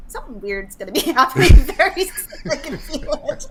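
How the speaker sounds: words breaking up into real laughter; laughing. Real, not acted. Woman recalling her bad feeling at the airport, laughing. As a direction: laugh through the line.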